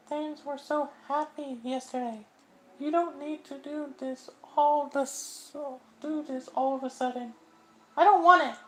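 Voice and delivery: a high voice pushing help away; high girlish distressed voice; Sayori's voice. Acted. Voicing Sayori.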